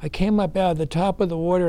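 ordinary talk, nothing marked

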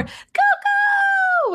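put-on squealed high, happy toddler shout; excited child's call. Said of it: "Fox will run to the door".

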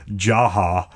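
ordinary delivery

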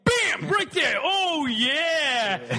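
high, shouting hype voice; excited TV chef. Retold or imitated. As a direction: pump it up like a TV chef. Voicing TV chef sex instructions.

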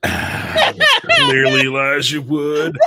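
high, very slow, laughing near-shout; exasperated correction. Real, not acted. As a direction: drag it out in mock disbelief. Exasperated fact-check correction.

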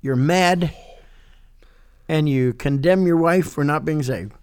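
ordinary talk, nothing marked

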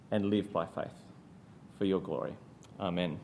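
hushed, reverent prayer voice. Acted. Preacher opens the sermon praying to God, softly reverent. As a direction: pray quietly and humbly to God.

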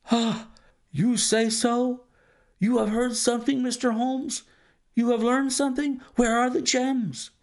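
high, breathless anxious questions; banker's voice pitched high with urgency; eager banker. A narrator's reading. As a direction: clutch at any hope.

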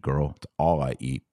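ordinary delivery

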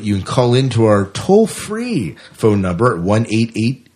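ordinary talk, nothing marked

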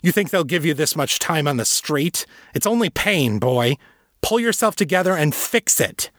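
high-pitched angry scolding of a son; harsh fatherly demand. A narrator's reading. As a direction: berate the boy, voice pushed up high. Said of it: "Father demanded".

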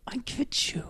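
hushed whisper of shared understanding. Retold or imitated. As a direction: breathe it like a secret. Whispered line of two mothers.

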